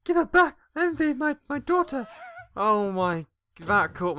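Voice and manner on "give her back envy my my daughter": high-pitched dramatic outburst; frantic demand. Acted. Parent demanding the daughter back, frantic. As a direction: cry out like a desperate parent.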